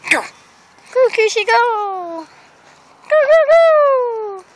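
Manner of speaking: high coaxing pet voice. Real, not acted. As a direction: coax the dog sweetly. Coaxing the dog.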